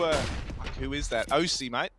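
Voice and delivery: ordinary delivery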